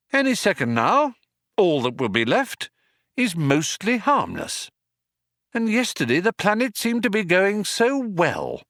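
high, measured, mournful inner monologue; Arthur. Acted. Doomed man thinks over his lost planet, plaintive.

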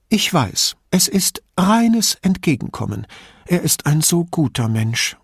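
put-on old lady, indignant and chatty; old signora. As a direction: play the suspicious old woman complaining about thieves. Old signora praises doctor.

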